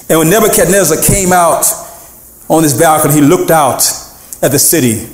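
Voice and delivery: ordinary delivery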